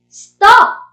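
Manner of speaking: loud, high exclaimed order; forceful imperative; sharp command. Shown as a demonstration. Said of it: "if you are doing a command".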